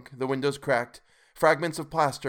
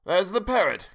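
higher, louder glad cry; delighted cry; 8 semitones higher, 5 dB louder than usual. A narrator's reading. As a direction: point it out with delight. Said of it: "cried Scrooge".

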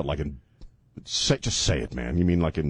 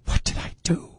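whispering. Retold or imitated. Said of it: "just went".

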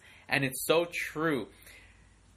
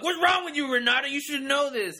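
much higher incredulous mock scolding; imitated listeners; 8 semitones higher than usual. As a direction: tease her as a baffled audience. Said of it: "people are probably be like".

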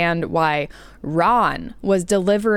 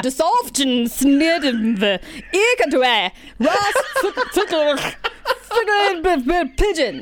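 lightly voiced, slow, angry reading of gibberish; half-hearted angry recital; mock angry reading; 10 semitones higher than usual. Friend reads keyboard-mash notes aloud, mock angry. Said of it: "did I encompass your anger properly".

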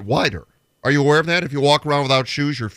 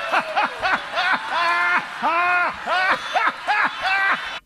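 cracking up hard, voice shooting up; big laugh; 4 dB quieter than usual. Real, not acted. Cracks up at Shamu bit.

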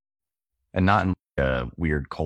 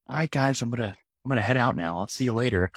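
much higher, airy mock-cheerful farewell; mock breezy goodbye; 8 semitones higher than usual. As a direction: play it as a carefree guy leaving the party. Leaving a Super Bowl party casually.

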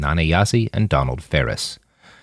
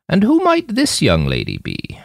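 put-on high, kindly old woman's question. A narrator's reading. Old woman asking about the girl, high and kindly. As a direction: lift it up high like a curious grandmother. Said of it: "Nanayazi asked".

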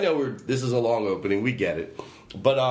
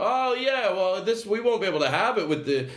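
mock bird owner; 7 semitones higher than usual. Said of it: "You know".

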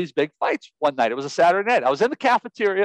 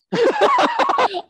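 burst of laughter. Real, not acted. Laughs at his own confession.

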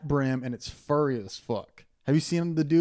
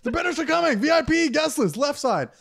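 high, hammed-up venue announcer; event announcer; 12 semitones higher than usual. Door staff directing band's guests, mock announcing. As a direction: call it out high to a concert crowd. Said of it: "It sounds like a band".

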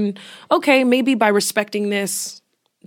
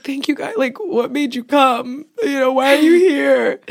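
crying thanks; 7 semitones higher, 6 dB louder than usual. Real, not acted. Tearful thanks to mom and sister.

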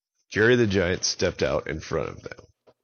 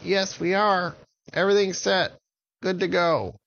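high small confident voice; high little voice; 12 semitones higher than usual. Acted. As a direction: chirp it like a tiny giant. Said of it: "And Jerry said".

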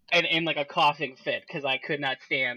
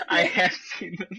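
words squeezed through laughter; can barely say it for laughing; laughing while talking; 3 semitones higher than usual. Real, not acted. Laughing at the Toad covers.

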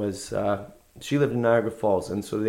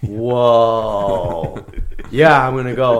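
excited; 9 dB louder, much slower than usual. Retold or imitated. Excited reaction to the trip.

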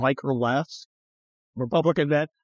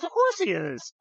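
high-pitched imitation of a co-host; lifted, mock-feminine voice; Irina; 11 semitones higher, 3 dB quieter than usual. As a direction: mimic her matter-of-fact reply up high. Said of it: "Irina's like".

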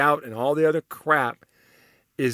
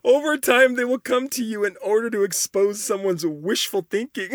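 barely holding back laughter; laughing while reading; 6 semitones higher than usual. Real, not acted. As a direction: reread it high, giggling at how harsh it sounds. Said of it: "I'm laughing 'cause that sounds so harsh".

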